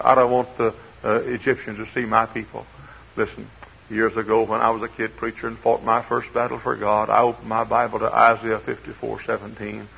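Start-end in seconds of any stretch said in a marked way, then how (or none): none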